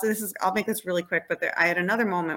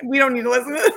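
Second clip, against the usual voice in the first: higher and louder, fed-up retold scolding; exasperated scolding; 6 semitones higher, 7 dB louder, much faster than usual. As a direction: raise it at the sulking child, impatient. Mother scolding sulking daughter.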